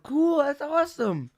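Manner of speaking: high, slow, forced cheer; a woman at a club feigning interest after googling him; fake enthusiasm. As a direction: paper over the awkwardness with fake cheer.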